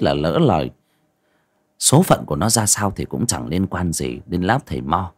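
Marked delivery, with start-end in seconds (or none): none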